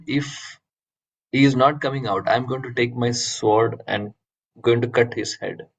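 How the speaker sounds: normal talking voice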